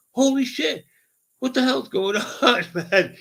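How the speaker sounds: worked-up "what is happening" outburst; alarmed exclamation. Retold or imitated. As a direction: exclaim it high, reliving the shock. Acting out his panic on highway.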